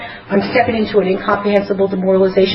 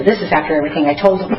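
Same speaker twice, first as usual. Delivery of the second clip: ordinary talk, nothing marked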